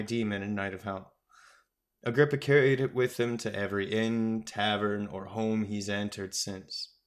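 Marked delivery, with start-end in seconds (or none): none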